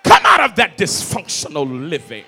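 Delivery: loud, impassioned sermon shout; full-force preaching. Real, not acted. Preacher refusing to sit with a toxic family, shouting. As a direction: thunder it out from the pulpit.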